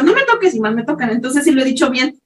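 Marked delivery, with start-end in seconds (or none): 0.0-0.5 snapped retort. Retold or imitated. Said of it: "le he dicho".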